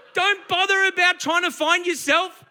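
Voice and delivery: normal talking voice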